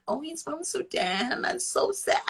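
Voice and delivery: exaggerated sorry-for-you imitation; mock pity. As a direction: mimic someone cooing with pity. Mocking people who pity Sudan.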